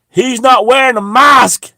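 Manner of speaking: high, loud, slow outraged yell; a shrill accusing cry down the aisle; mock shout. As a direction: shout it across the shop like a tattletale.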